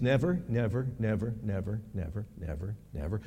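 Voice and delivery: echoing never dying out; fading repetition. Acted. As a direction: let each never fade away. Repeats never, fading to hush.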